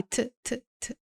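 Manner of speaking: isolated "t, t, t", light and quiet; soft T sound. Shown as a demonstration. Teacher isolating the Spanish T, soft little "t" ticks. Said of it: "sempre suave".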